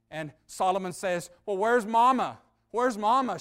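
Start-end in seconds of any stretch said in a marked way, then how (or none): 1.4-3.4 higher, louder urgent question; alarmed father, retold. Solomon asks his son where Mama is, alarmed. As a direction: voice a father's mild alarm, pitched up.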